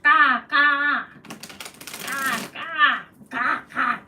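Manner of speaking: loud cawing like a crow; crow caw imitation. Shown as a demonstration. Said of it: "Can you do the real sound?".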